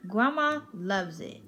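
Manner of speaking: ordinary delivery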